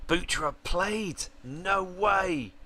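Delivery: high, slow disbelief; disbelieving no way. Real, not acted. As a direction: stretch it out, you can't believe he played. Disbelief at a result.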